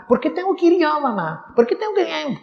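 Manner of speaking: put-on high, whiny kid asking his mom; whiny teen protesting to his mother; whining child. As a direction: complain like a kid who doesn't want to go.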